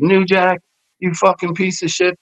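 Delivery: strained retold holler at normal volume; imitated yelling. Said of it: "he starts yelling at New Jack".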